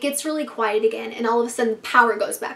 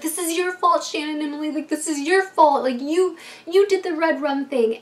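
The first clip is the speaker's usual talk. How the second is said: put-on higher, blaming girl voice; accusing; 5 semitones higher than usual. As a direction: point the finger at them. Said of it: "and they're like".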